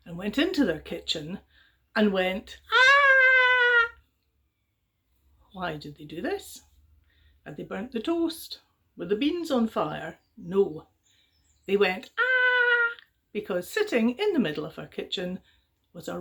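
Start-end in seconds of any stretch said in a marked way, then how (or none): 2.7-3.9 high loud shriek of fright; sudden shocked yelp; startled scream. Acted. Villager finds rat in kitchen. 12.2-12.9 high loud shriek of fright; sudden shocked yelp; startled scream. Acted. Villager finds rat in kitchen.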